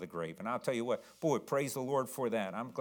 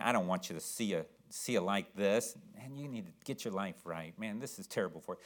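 quiet reply; 6 semitones lower, 7 dB quieter than usual. Retold or imitated. You answer the drunk kindly.

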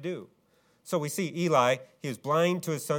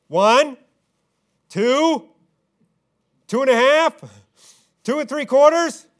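high, loud parent counting to three; hammed-up parent yelling a countdown; mock yelling countdown; 9 semitones higher, 11 dB louder than usual. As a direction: yell the count like an exasperated parent. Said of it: "what are they doing? Just yelling".

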